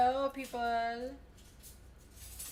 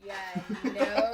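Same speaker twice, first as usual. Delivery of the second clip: ordinary delivery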